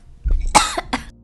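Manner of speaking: sudden high choking cough. Real, not acted. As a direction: cough hard, caught mid-word. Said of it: "sorry, I was choking".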